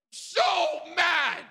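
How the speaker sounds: high, loud hammed-up shout; comic fury. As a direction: blurt it out at full acted rage. Climax of his acted rage.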